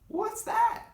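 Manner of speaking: put-on high little-girl squeak; high squeaky sister voice.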